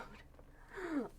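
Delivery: high breathy giggle, catching her breath; breathless high giggle. Real, not acted. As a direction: laugh it off while short of breath. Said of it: "laughs at her breathing".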